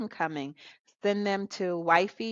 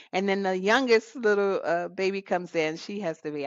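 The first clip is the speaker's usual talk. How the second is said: ordinary talk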